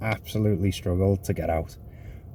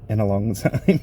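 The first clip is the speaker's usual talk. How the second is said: laughing. Real, not acted. Laughs at his own bad review.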